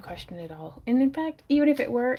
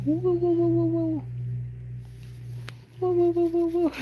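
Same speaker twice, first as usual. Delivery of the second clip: playful woos; 9 semitones higher than usual. Real, not acted. Playful noises to the dog.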